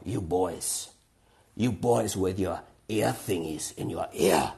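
put-on slow old woman's voice with German accent. Old woman addresses two boys in headphones, German accent. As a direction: imitate an elderly German lady, drawn out. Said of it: "this German woman with a German voice".

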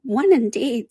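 high, huffy little creature; mildly affronted dormouse; indignant. Acted. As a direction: retort huffily, pitched high. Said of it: "said the Dormouse indignantly".

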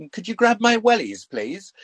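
mock British request.

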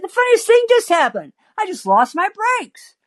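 high, breezy retelling to a stranger; over-friendly, high-pitched chirp. Acted. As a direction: pitch it way up, playing friendly and amused. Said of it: "to show him how friendly I am".

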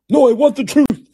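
high, loud mock Tom Cruise shout; Tom Cruise impression. Host answers his own Nicholson as a loud, high Tom Cruise. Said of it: "That's Tom Cruise".

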